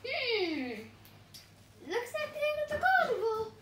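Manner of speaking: high, fast sighing voice; high cartoon pineapple. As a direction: lament it in a squeaky voice. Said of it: "said one pineapple".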